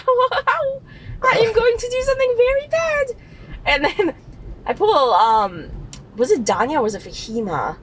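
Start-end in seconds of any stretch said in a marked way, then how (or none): 0.1-3.1 high, far-off mock inner voice; scheming inner voice. Her brain hatches a prank, high mock inner voice. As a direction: voice the plotting brain, pitched way up. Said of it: "my brain is like".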